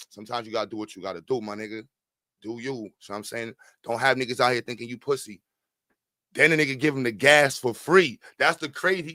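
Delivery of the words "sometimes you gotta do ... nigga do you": low egging whisper. Retold or imitated. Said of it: "talking about".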